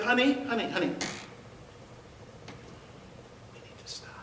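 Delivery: soft, whispered plea; gently. Retold or imitated. Husband gently calming his angry wife, whispered. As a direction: whisper it to calm her down. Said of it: "So I gently said".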